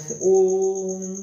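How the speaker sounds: ओम held very long and slow; long drawn Om. Shown as a demonstration. Teacher showing the class a long drawn ओम. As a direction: chant ओम with a stretched vowel. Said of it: "लंबा त्रिमात्रा का समय लगता है".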